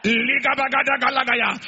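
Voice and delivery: loud burst of tongues; speaking in tongues. Real, not acted. Preacher breaking into tongues mid-prophecy, loud. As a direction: let the tongues pour out loud.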